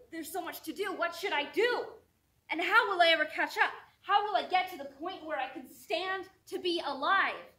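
loud, high, building panic; frantic questions rising to a shout; anxious outburst. Acted. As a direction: cry out as anxiety itself, overwhelmed. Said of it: "The anxiety says".

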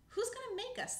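high loud squirrel challenge; high loud defiant squirrel; Burke's voice. Acted. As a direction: sneer it out high. Burke voiced, picking a fight.

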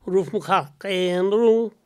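put-on very slow, broad Cork accent. [name] performs a thick Cork accent for the host, very slowly. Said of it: "That's a great accent".

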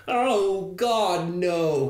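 lightly acted "Oh God, no"; mock panic. Shown as a demonstration. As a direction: demonstrate a half-hearted panicked cry. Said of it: "And then we go".

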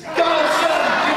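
high, loud call. Acted. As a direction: call it out to the sky. Call response in story.

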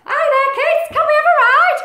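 high, loud cartoon animals; animal character voices. Zebra and Rhino asking the kids for a ride, high and loud. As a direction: call out eagerly for a ride.

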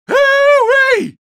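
high hollering whoop. Acted. Whooping at surrounding the enemy.

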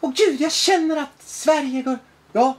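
high, drawn-out gushing lament; mock someone overwhelmed by feeling, slow and high; hammed-up emoting. Mimicking a woman who only feels, overwrought mock lament. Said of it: "Hon bara".

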